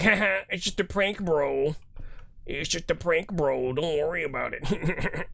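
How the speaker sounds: mock prank bro. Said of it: "You're like".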